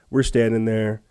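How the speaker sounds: ordinary delivery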